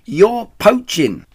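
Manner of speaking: high, loud, drawn-out accusation; indignant shriek. A narrator's reading. Rat catching them at his cider, indignant shriek. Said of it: "shrieked Rat".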